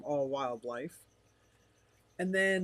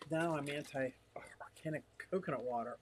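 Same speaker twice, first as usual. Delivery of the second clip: quick unvoiced whisper, much softer; 7 dB quieter than usual. Real, not acted. Streamer whispering a chat comment aside.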